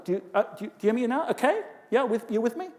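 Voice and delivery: every phrase ends high on a question; demonstrating uptalk to the room; rising uptalk. Shown as a demonstration. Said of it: "young people do pitch raises".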